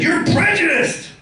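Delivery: high, drawn-out mock accusation; accusing neighbour. Neighbour accusing the comedian in a parking row, mock indignant. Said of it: "and he said".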